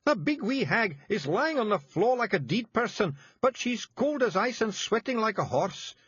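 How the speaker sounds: high, thick Scots pixie voice; small tough Scots fairy breaking bad news; Scottish Feegle voice. A narrator's reading. Feegle Rob urgent.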